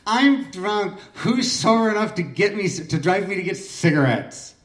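put-on high, whiny drunk plea; mock whine asking for a ride; whiny drunk girl. Drunk Christine begging the room for a ride, high whiny voice. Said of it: "she says in this whiny voice".

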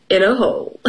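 plain reading voice

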